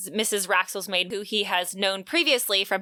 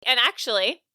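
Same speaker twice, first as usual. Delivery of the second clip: ordinary talk, nothing marked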